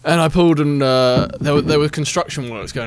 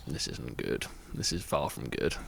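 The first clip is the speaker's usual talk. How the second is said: low, much softer inner voice; inner worry; 12 semitones lower, 14 dB quieter than usual. Retold or imitated. Driver seeing angry man by car, low quiet thought. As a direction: think it under your breath, worried. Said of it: "I'm thinking".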